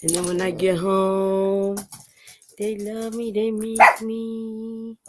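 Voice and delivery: slow, drawn-out whiny dog voice; voicing her mopey dogs. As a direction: stretch each phrase into a pining wail. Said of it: "They're like".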